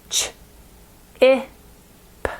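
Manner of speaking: segment the word sound by sound; sounding out chip. Shown as a demonstration. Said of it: "change my I".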